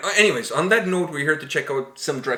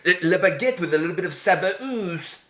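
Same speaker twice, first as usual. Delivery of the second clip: playful fake Quebec French accent; hammed-up French Quebec accent; 3 semitones higher than usual. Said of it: "my French Quebec accent".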